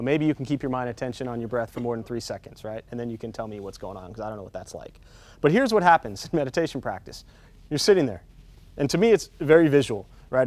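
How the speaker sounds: usual speaking voice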